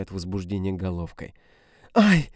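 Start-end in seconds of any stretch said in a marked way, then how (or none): 1.9-2.3 much higher, louder yelp; woman crying out in pain; sharp cry of pain. A narrator's reading. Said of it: "вскрикивает она".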